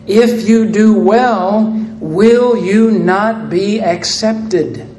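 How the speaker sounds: slow with space between the words; slow solemn reading of God's question; slow, weighty. Acted. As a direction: lay each word down slowly. God's words read slowly, pleading.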